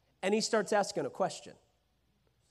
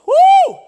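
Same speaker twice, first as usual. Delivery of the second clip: loud high whoop of delight; excited whoo; 20 dB louder than usual. Acted. Jesus celebrating Peter's answer with a loud whoop. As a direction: let out a joyful whoop.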